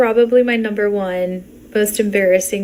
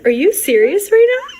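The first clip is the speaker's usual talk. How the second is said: high-pitched retold disbelief; incredulous; 10 semitones higher, 3 dB louder than usual. Woman to the laughing guy, incredulous. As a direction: challenge him in a raised pitch. Retells confronting her laughing date.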